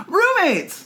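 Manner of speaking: high-pitched pretend heckle from the crowd; audience shout-out. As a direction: call it out like an eager audience member. Audience member calling out improv suggestion.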